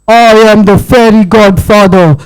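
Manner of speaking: tiny fairy booming his name to Inga; big-voiced fairy godfather. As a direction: announce yourself grandly. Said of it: "such a big voice".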